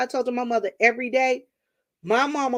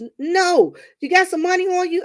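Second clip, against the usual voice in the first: higher, louder mom on the phone; higher mock mom voice; mother's voice; 10 semitones higher, 6 dB louder than usual. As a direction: voice mom brushing off the visit. Mom voiced in retold call.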